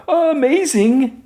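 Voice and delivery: very high, stretched-out "Amazing!"; Jimmy gushing to the promo camera, high and slow; gushing amazement. Retold or imitated. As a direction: draw it out, wide-eyed and thrilled.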